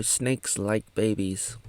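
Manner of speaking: ordinary talk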